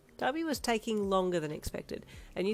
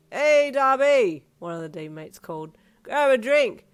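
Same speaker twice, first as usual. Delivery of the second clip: put-on drunk teammate calling; 6 semitones higher, 12 dB louder, slower than usual.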